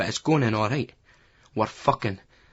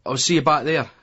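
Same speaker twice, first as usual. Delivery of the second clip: called out, higher and louder; calling out; 4 semitones higher, 5 dB louder than usual. A narrator's reading. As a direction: call it over your shoulder. Calling out while leaving.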